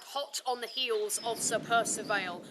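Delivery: ordinary delivery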